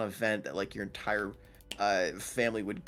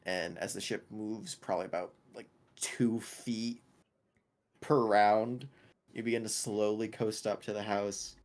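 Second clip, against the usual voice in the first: usual speaking voice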